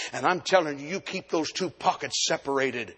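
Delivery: usual speaking voice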